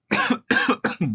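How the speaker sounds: harsh loud cough; a sudden hacking cough; coughing fit. Real, not acted. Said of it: "I have the flu right now".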